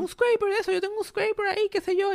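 high, lightly voiced chatty neighbor; eager neighbor. The neighbor offering a scraper, in a high put-on voice. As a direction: squeak out the offer like an overeager neighbor. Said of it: "Y viene él y me dice".

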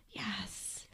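hushed, whispered cheer; mock-solemn whisper. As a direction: whisper it like secret encouragement.

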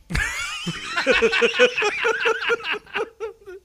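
long, high-pitched laughing fit; helpless laughter. Real, not acted. Laughing at friend's seasonal section mistake, high cackling fit. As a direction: crack up and keep going. Cracks up at own jibe.

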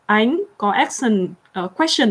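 Ánh said very slowly, rising like a question; slow question intonation. Shown as a demonstration. Teaching Americans to say her name, Ánh rising slowly like a question. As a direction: stretch out Ánh with a questioning lift. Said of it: "giống như kiểu câu hỏi ấy".

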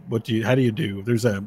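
ordinary delivery

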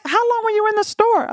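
high lightly voiced husband, pretty much her own voice; a hint of an exasperated husband; mock husband. Husband asking wife after store trip, mock exasperated. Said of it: "He was like".

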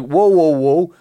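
loud startled "whoa, whoa, whoa"; wife's hammed-up fright at the window; mock shock. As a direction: recoil in mock alarm. Wife sees dead husband.

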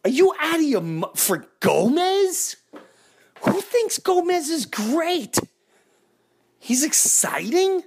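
high-pitched indignant fan rant; rant, pitch climbing with outrage; escalating comedy rant. Real, not acted. Fan rants about bad trade.

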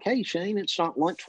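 Mrs Cooper's greeting. Retold or imitated. Mrs Cooper greets him, retold.

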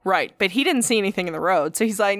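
ordinary delivery

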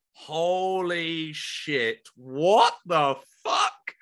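slow, drawn-out amazement; stunned disbelief. Retold or imitated. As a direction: stretch each word out, dumbstruck. Stunned at being greeted by idol.

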